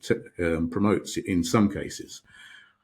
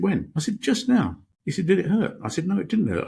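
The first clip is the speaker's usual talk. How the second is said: losing patience, voice climbing; man fed up with the monk's game; exasperated; 11 semitones higher than usual. Retold or imitated. Third round of kicking exchange.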